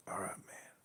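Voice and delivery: very soft, almost whispered farewell; retold resigned goodbye. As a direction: let it fade to nearly nothing.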